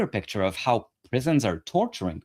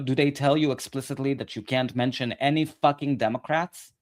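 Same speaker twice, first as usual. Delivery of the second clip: usual speaking voice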